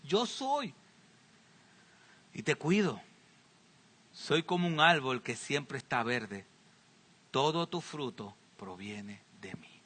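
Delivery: dropping low and soft, almost to a whisper; hushed voice of God. Acted. Preacher voicing God tenderly to Israel, hushed. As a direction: speak God's promise gently, fading to breath.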